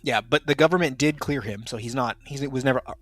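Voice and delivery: usual speaking voice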